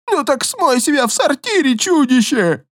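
high frantic yell; panicked roar. Acted. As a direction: shriek at the monster. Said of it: "взревел мужик".

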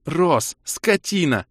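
high female cry of indignation; outraged rebuke. Acted. Kira insulting the hero over his joke, outraged.

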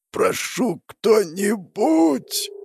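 high, weak, drawn-out begging; desperate plea. Acted. Dying hero calling for anyone, slow desperate plea. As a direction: beg anyone for help, stretching the words slowly.

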